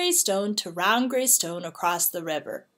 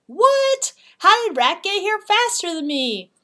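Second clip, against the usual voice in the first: disbelief; 9 semitones higher, 4 dB louder than usual. Acted. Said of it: "He couldn't believe his eyes".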